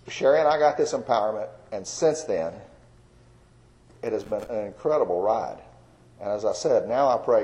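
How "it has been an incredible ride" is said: normal talking voice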